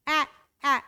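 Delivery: "a, a" as an open short æ; short a vowel. Shown as a demonstration. Teacher isolating the vowel in cat. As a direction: open the mouth for a flat "a". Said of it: "it's not kate".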